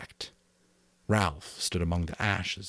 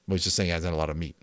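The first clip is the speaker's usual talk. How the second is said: usual speaking voice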